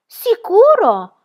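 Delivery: high, loud, drawn-out Sicuro; surprised. Acted. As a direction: stretch it slowly in loud disbelief. Pippi answering the shopkeeper.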